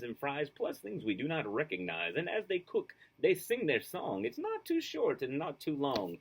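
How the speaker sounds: put-on lively, sing-song reading to children; storybook read-aloud. A narrator's reading. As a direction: read it like a bedtime picture book. Reads picture book aloud.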